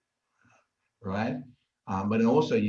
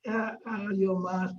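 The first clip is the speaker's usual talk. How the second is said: mock distant yell; 9 semitones higher, slower than usual. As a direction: imitate a man yelling from way over. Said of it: "some guy yelling, screaming at me".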